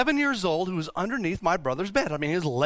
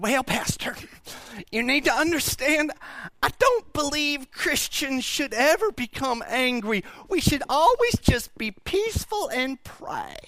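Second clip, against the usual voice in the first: nice churchgoer; 10 semitones higher than usual. Retold or imitated. Churchgoer gently correcting the pastor after service, high mock-nice voice. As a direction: imitate a sweetly objecting parishioner, pitched high. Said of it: "really nice person come up to me".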